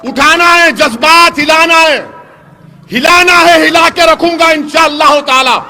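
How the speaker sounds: rising rally roar; fiery shout. Real, not acted. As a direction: shake them up with it. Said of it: "جوش پیدا کرنا ہے".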